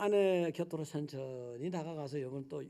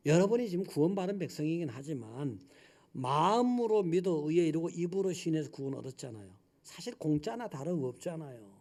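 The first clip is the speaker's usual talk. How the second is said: usual speaking voice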